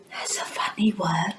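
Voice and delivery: whispered aside about the funny word; playful hushed comment. Pretend. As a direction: whisper it to the children. Aside after funny demo.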